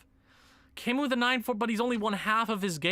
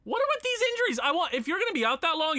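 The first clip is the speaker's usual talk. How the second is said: exasperated rant; 9 semitones higher, faster than usual. Real, not acted. Annoyed at injuries.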